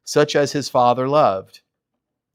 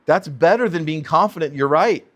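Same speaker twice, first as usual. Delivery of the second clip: ordinary talk, nothing marked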